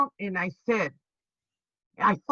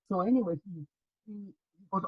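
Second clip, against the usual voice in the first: usual speaking voice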